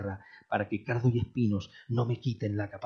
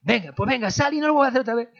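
mother's voice; 13 semitones higher, 9 dB louder, faster than usual. Retold or imitated. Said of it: "mi mamá dice".

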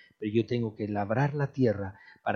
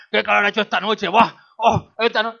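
complaining neighbours; 9 semitones higher, 12 dB louder than usual. Retold or imitated. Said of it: "Que los de arriba están diciendo".

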